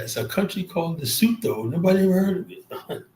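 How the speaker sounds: a hint of a dismissive president; imitating Trump. Streamer quotes the president's dismissive remark with a hint of mockery. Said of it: "like Trump said that".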